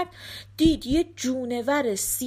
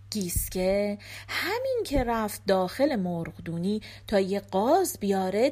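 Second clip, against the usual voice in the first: ordinary delivery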